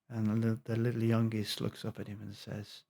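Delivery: ordinary talk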